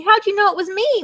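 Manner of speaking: a touch of hammed-up dismay; mock moan. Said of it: "moaned Turkey".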